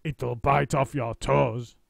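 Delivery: husband teasing with a slow scare; menacing. A narrator's reading. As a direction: draw it out to scare her. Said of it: "said Mister Twit".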